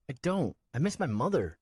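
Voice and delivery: put-on high young boy's voice, pleading; a small boy reminding the man; child voice. Nico to Swen, a high boy's voice.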